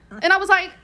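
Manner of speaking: ordinary talk, nothing marked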